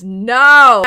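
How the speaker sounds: sudden loud cry of no; shouting no. Retold or imitated. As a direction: blurt out a loud no. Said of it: "a note further down that says".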